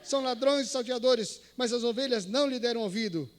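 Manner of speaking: loud, solemn reading of the verse; scripture reading. Shown as a demonstration. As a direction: read it out to the prisoners. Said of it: "cheguei no versículo oito e disse".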